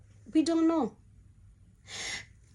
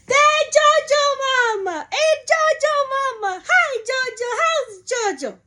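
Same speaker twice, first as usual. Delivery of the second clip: cafeteria aunties screaming; 12 semitones higher than usual. Retold or imitated. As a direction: sing out the nickname across the cafeteria.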